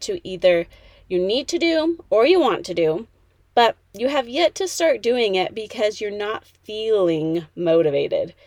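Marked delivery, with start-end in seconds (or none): 6.1-8.3 "feeling" drawn out for emphasis; stress on feeling. Real, not acted. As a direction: lean on the word "feeling", stretching it. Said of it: "I'm emphasizing the word feeling".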